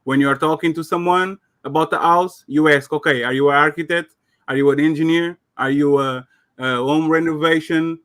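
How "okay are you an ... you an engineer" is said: normal talking voice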